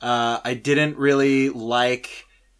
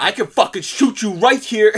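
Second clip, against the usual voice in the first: louder, higher threat; menacing tycoon; 8 semitones higher, 5 dB louder than usual. Retold or imitated. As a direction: threaten the fan like a gangster. Rich exec threatening.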